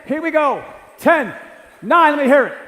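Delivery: loud and high, yelling over the jumping room; pumping up the crowd. Real, not acted. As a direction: whip the audience into a frenzy, shouting. Hyping crowd, jumping, countdown.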